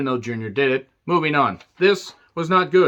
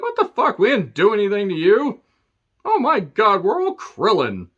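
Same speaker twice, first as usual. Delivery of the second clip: high, louder mock panic in a put-on voice; panicked horses; 7 semitones higher, 3 dB louder than usual. Budweiser horses panicking at the Clydesdale taunt, hammed-up high panic. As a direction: squeal like terrified cartoon horses. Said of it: "the Budweiser horses get panicked".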